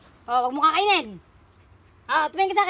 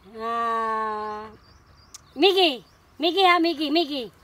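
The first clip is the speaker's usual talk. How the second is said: usual speaking voice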